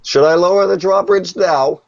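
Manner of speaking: high put-on voice, calling from afar; underling calling out. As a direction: call up a question in a silly high voice. Game master voices an underling calling out.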